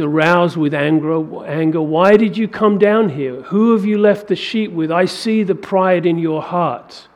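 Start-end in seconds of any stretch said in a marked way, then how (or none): 1.8-7.1 higher, louder scolding rebuke; older brother Eliab scolding David at the front line; accusing older brother. Acted. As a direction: cut the younger brother down to size. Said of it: "He accuses David".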